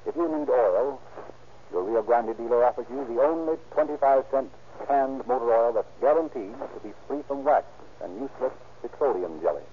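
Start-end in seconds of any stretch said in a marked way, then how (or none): none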